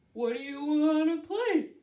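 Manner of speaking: big loud bear character. Acted. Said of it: "Bear looked at this and said".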